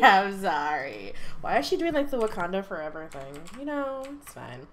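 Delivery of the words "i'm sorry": low, laughing apology. Real, not acted. Vlogger giggling at the grieving Sim, laughing apology. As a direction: let the giggle break through the apology.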